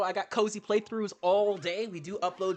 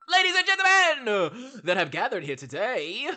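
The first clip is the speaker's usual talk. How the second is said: louder showman opening; mock announcer; 4 semitones higher, 6 dB louder than usual. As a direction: hype up the crowd. Introducing the performance.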